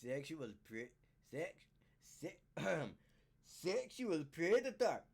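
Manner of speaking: low, halting attempt at a Scottish accent; tentative put-on Scottish accent; trying out an accent. Shown as a demonstration. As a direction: feel out a Scottish accent word by word. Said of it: "Let me try out my accent".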